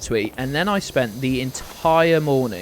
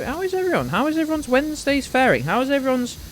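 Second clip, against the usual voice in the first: squeaky high greeting; 11 semitones higher, faster than usual. Pretend. Playful high greeting to chat.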